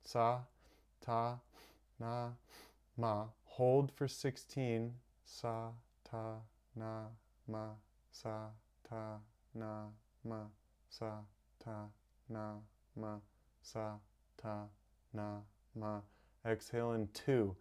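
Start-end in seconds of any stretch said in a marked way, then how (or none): 0.0-3.3 Sa, ta, na, ma counted slowly and evenly; slow rhythmic mantra count. Shown as a demonstration. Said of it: "So we inhale in four parts". 5.2-16.0 much softer, steady Sa ta na ma; soft guided mantra counting. Shown as a demonstration. Softly counting held breath.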